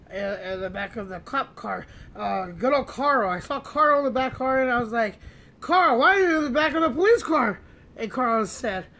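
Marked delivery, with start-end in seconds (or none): 5.6-7.6 loud, higher-pitched disbelief; baffled and loud; incredulous question. Retold or imitated. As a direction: question your friend in raised-voice astonishment. Startled question to friend in custody.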